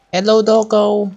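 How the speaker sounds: normal talking voice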